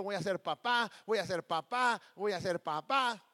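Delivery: loud, proud and elated; excited announcement. Retold or imitated. New father-to-be telling the whole world, excited and loud. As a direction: shout the good news to everyone. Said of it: "Y yo estaba emocionado".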